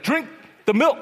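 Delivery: loud imitation of dad insisting; father's stern order. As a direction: order the kids like an impatient dad. Father orders children to drink milk.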